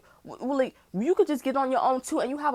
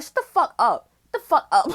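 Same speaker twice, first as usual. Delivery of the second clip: retold yell; 3 semitones higher, 6 dB louder than usual. Snapping back at the mother.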